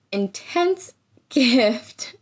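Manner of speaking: slow laughing drawl on intense gift; amused, dragged-out emphasis. Real, not acted. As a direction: laugh through the word, drawn out.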